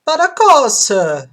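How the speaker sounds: high, loud Italian barber; Italian accent. Acted. Barber welcoming a customer, Italian accent. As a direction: play an exuberant Italian barber. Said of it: "platinum blonde with Italian accent".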